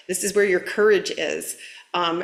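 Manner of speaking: ordinary talk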